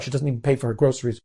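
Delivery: ordinary delivery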